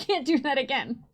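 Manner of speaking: normal talking voice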